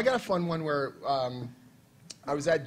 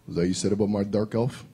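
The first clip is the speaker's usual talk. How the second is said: mock menacing; 7 semitones lower than usual. Author looms over him, menacing.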